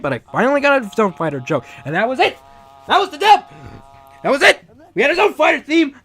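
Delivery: loud, high-pitched victory yell; building to exultant shouting; triumphant re-enactment. Retold or imitated. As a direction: shout it like you just finished a huge job. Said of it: "That's pretty much what I said when I finished it".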